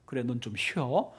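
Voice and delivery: low, soft brush-off; acted, unimpressed low aside; quiet dismissal. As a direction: wave them aside under your breath. God shrugging off the self-confident.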